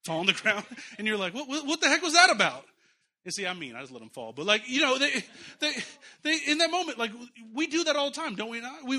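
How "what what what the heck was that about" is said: loud, high, stammered mock disbelief; startled outburst. Parent reacting to a child going noodly, startled outburst. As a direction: act out a flabbergasted parent, raising your voice.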